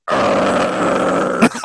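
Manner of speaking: long deep rising dog growl. Retold or imitated. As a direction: snarl like a huge dog. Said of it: "the dog's like".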